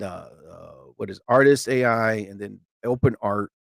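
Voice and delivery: ordinary delivery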